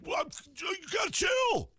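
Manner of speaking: high-pitched mock shriek; stretched, strangled comic shouting; Kyle snapping. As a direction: screech it as an exasperated caricature. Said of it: "And Kyle, like, looks at me".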